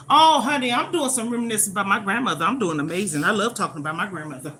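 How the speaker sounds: usual speaking voice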